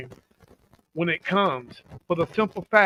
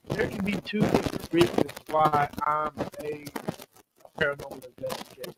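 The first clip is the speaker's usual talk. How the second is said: breaking up over a bad line; crackly cordless phone; 4 semitones lower, 5 dB quieter, faster than usual. Real, not acted. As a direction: static crackling through the phone. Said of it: "You're all staticky again".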